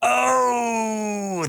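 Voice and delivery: high joyful cry of recognition; excited shout. Real, not acted. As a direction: shout it out as the answer lands. Recognizing the right answer.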